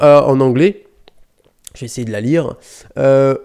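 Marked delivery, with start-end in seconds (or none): none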